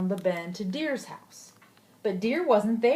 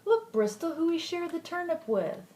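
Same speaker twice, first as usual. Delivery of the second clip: excited; 8 semitones higher than usual. Real, not acted. Excited aside to child.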